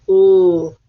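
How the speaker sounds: "ul" as a long, held L sound; letter sound demo. Shown as a demonstration. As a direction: hold the L in "ul", loud and drawn out. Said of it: "I hear the ul sound".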